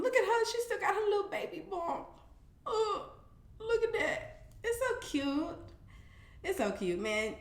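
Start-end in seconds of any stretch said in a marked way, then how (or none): 0.0-4.4 high, soft, doting coo. Real, not acted. Cooing over a newborn baby photo. 4.6-5.6 high, soft and doting; gushing coo. Real, not acted. Cooing over a newborn baby photo.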